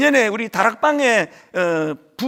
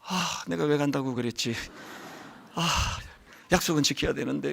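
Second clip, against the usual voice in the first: low, soft weary muttering to himself; worn-out inner complaint; tired grumble; 8 semitones lower, 10 dB quieter than usual. Retold or imitated. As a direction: sigh it out, drained. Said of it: "체력이 확 떨어지고".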